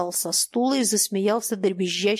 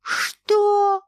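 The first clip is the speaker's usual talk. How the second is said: high thin squeal, louder; thin shriek; 12 semitones higher, 5 dB louder than usual. Acted. Old man squealing at the captain in disbelief. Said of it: "взвизгнул старик тонким голосом".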